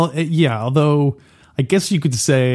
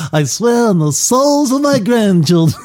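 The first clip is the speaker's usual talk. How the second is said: exaggerated mob-boss inflection, much higher; Don Corleone; 9 semitones higher than usual. Co-host mimics the host's version of the Don, exaggerated Corleone inflection. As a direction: overdo the godfather's lilt on the oath. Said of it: "the way you make, he's like".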